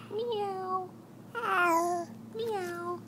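put-on high cat meow for a toddler. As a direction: play a kitty, high and soft.

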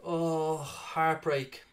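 ordinary talk

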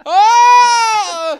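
long, high, loud moan; mock loud moan. Shown as a demonstration. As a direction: belt out a hammed-up moan, held long. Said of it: "'Cause I moan".

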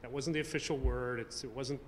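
normal talking voice

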